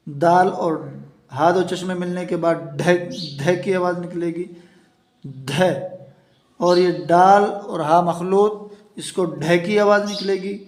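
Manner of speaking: ordinary talk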